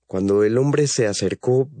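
ordinary delivery

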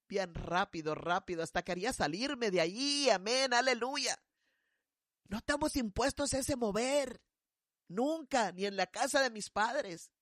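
usual speaking voice

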